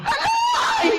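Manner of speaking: very high frantic cries; panicked calling. Acted. Wife screaming as husband collapses, terrified calls. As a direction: scream his name in terror.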